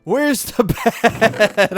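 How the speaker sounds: high, slow, loud cries of where's the bed; panicked yelling. Real, not acted. Gamer yelling at himself over the lost bed, slow high panic.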